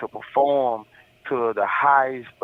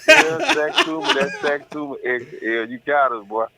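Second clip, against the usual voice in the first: laughing outburst; 3 semitones higher, 3 dB louder than usual. Retold or imitated. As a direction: blurt it out cracking up at the jinx. Retelling his own laughing outburst.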